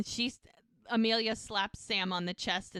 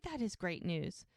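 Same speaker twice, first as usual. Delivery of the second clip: lower, softer, measured agreement; reserved echo; 4 semitones lower, 8 dB quieter than usual. Retold or imitated. Mom echoes dad before raising doubts, reserved and low. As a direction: agree with a reservation held back.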